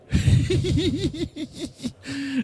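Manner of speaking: high, long rippling laugh; real laugh. Real, not acted. Preacher amused by own story, high giggling laugh. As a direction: giggle helplessly at a high pitch.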